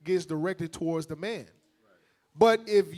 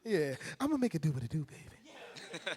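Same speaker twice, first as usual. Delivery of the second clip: soft sweet-talk; 2 semitones lower, 8 dB quieter than usual. Pretend. Husband sweet-talk continued.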